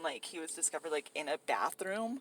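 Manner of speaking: ordinary delivery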